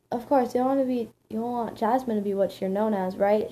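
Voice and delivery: ordinary delivery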